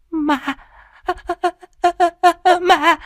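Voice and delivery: shrieking high and drawn out in terror; slow, piercing cry of a woman who has seen a ghost; terrified scream. Acted. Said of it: "gào lên trong kinh hãi".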